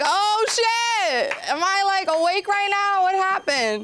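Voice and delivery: high, shocked gasping disbelief; stunned. Retold or imitated. Woman stunned by the hot pharmacist, squealing high in disbelief. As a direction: squeal it like you can't believe your eyes.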